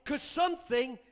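slow, emphatic, rising preacher; full-force preaching. Real, not acted. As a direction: hammer the point home to the congregation.

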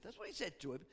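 ordinary talk

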